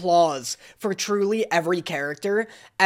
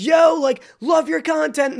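eager fan; 9 semitones higher than usual. Retold or imitated. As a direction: gush at him like a fan. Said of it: "he's like".

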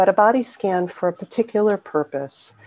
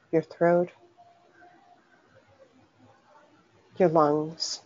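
unhurried meditation voice with long pauses; guided meditation. Acted. Teacher leading listeners through a soothing body scan, guided meditation. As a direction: guide them gently inward, phrase by phrase, leaving long silences.

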